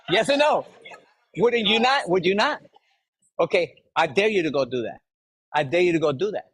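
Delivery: ordinary talk, nothing marked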